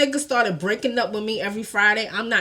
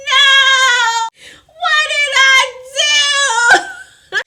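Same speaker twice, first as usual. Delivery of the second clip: hammed-up high, loud crying; heartbroken wail; 8 dB louder, much slower than usual. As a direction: wail it out in long, drawn mock sobs. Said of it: "My heart was so broken".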